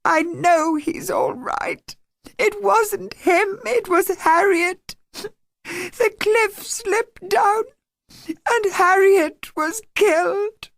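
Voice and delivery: high, slow, tearful outburst; girl in anguish telling of the dead Harriet. A narrator's reading. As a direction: break out in grief, dragging the words. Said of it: "burst out in anguish".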